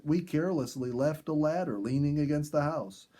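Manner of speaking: ordinary delivery, nothing marked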